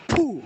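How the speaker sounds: quick forceful blow of air; sharp ritual puff. Real, not acted. Healer finishing a whispered prayer, sharp blown puff. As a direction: puff out hard after the prayer. Healer blows after incantation.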